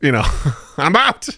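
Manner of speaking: high, laughing sing-song goodbye; cheery farewell. Retold or imitated. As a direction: wave off the world as you drop off. Falling asleep, cheerful goodbye.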